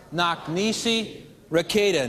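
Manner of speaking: slow, paced "knock nisi requeta"; slow lead. Shown as a demonstration. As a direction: cue the audience to chant along. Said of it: "say it for me".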